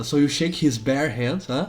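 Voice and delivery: normal talking voice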